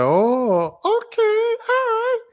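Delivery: very high-pitched Disney Channel voice; drawn-out, squeaky-high imitation of a TV starlet; Zendaya. Teen star laughing off a fan's marriage proposal, imitated Disney voice. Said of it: "her voice was like a Disney voice".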